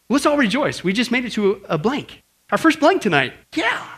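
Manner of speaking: high, excited mock celebration. Real, not acted. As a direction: cheer over a tiny victory. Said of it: "Okay, I was excited".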